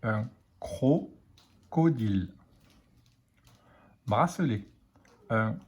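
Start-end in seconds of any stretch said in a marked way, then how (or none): 0.0-2.3 slow, syllables apart; careful dictation pace. Shown as a demonstration. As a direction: dictate "Un crocodile" slowly, cro-co-dile. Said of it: "Il manque la syllabe cro".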